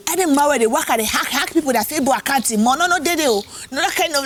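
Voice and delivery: high, animated Batibo woman; Batibo accent. Acted. Warns Facebook hackers on brother's phone, high Batibo accent. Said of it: "warn them in the accent".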